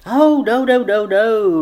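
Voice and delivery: high, hasty run of denials; rushed on. A narrator's reading. Sally hurries to reassure Nancy it's no date, rushed. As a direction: rush to head off the objection. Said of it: "rushed on Sally".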